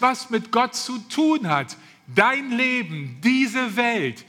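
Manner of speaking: ordinary delivery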